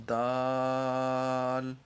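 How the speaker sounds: دال stretched long over five beats, even and slow; drawn-out letter. Shown as a demonstration. Teacher showing students how to stretch دال, very slow. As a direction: hold دال for five counts. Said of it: "پانچ حرکات کے برابر کھینچ کر پڑھا جائے گا".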